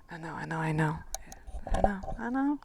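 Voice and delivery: soft whispered I know; whispered concession. Real, not acted. Conceding to the viewer it's hard, in a whisper. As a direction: admit it under your breath.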